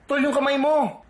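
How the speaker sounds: heightened warning. Acted. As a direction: pitch it up in alarm. Second take, more alarmed.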